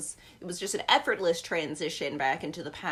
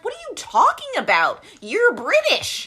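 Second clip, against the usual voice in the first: much higher and louder, stunned disbelief; incredulous outburst; 13 semitones higher, 8 dB louder than usual. Retold or imitated. Reacting to the amnesiac patient, incredulous and loud. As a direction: pitch jumps up in mock outrage at a fake foreigner. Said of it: "And I'm like".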